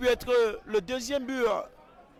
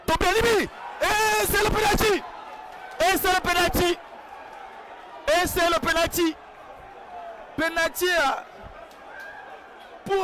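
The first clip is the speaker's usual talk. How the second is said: excited high shouted penalty call; esports caster; 7 semitones higher than usual. Real, not acted. Penalty awarded, live commentary.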